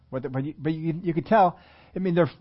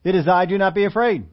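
raised, loud preaching read; scripture reading; 4 semitones higher, 4 dB louder than usual. Acted. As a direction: proclaim the verses with force.

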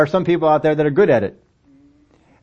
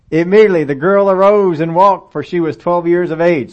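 loud, raised preaching read of scripture; 4 semitones higher, 7 dB louder than usual. Acted. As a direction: proclaim the passage to the whole church.

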